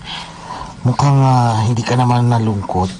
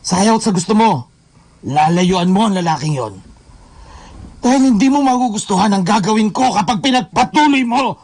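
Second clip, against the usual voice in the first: much higher, heated threat; menacing threat; 11 semitones higher than usual. Acted. Jealous boyfriend warns Laika to stay away, heated threat. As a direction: threaten her, voice pitched up.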